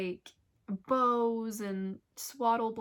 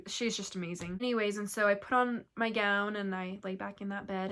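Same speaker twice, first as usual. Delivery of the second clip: usual speaking voice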